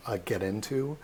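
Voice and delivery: usual speaking voice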